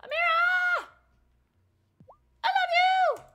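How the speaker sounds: shouting. Real, not acted. Shouting love at game character.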